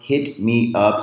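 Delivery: ordinary delivery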